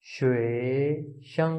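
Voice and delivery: "श्वे शं" stretched very slowly with clear tones; slow Chinese demo. Shown as a demonstration. Teacher repeating the word slowly for learners. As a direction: drag out "श्वे शं" to model it. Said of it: "शीन की आवाज़ दे रहा है".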